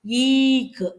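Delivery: ஈக்கு with ஈ held high and long; ஈக்கு as a slow "eeek"; long vowel, closing k. Shown as a demonstration. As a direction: draw out ஈ and end on a brief க். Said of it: "இக்கு வச்சு இருக்குங்க".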